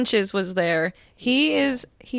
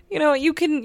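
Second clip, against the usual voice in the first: normal talking voice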